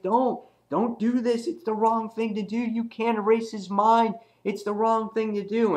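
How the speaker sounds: very high put-on tearful pleading; mock crying. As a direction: imitate someone begging through tears. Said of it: "and he's crying".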